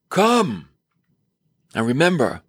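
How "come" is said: high, commanding cry; thundering command. Acted. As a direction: call out like a thundering voice. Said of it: "it's a thundering voice".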